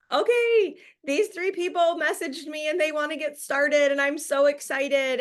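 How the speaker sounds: hammed-up excited prospect voice; imitated excitement. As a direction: play the thrilled new prospect gushing. Acting eager new prospects' message.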